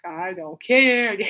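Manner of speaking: slow, low start into a drawn-out word; imitating exaggerated apathy; sarcastic indifference. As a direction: drag the last word out, mockingly. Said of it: "his sarcasm pretty much matched my sarcasm".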